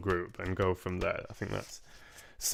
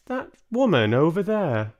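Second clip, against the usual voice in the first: put-on high, loud, teasing old lady voice; a grandmother about to make mischief; sly grandmother; 8 semitones higher, 6 dB louder than usual. Grandmother baiting Mister Jenkins, high mischievous voice.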